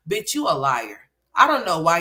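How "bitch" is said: ordinary talk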